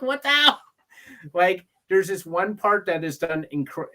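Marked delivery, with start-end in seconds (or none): 0.0-0.6 high, loud exasperated cry; mock exasperation. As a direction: yelp it like a flustered director. Mimicking Caine's outburst.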